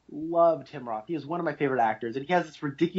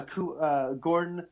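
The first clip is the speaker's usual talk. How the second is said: ordinary talk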